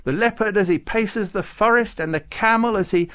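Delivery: high, loud celebratory recital; happy rejoicing. A narrator's reading. Farouk listing rejoicing creatures, happily. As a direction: exult through the list, pitched well up. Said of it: "Farouk said happily".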